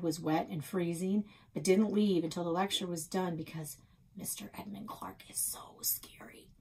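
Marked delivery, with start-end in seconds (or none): none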